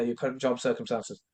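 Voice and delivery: usual speaking voice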